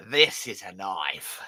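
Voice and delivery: Crocodile Dundee impression. Host imitating Crocodile Dundee showing his knife, slow and proud. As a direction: boast "This is a knife" slowly like Dundee. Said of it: "that was another one".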